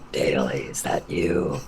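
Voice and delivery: extremely low, creepy creature voice; freaky monster voice. Acted. Prank caller freaking out a friend, deep monster voice. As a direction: rumble it right at the bottom of your range. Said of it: "freaking out my friends with that voice".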